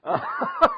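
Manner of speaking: high-pitched amused laugh; laughing at a fond memory. Real, not acted. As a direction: burst into high giggles.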